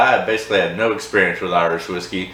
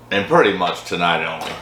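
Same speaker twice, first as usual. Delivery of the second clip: ordinary talk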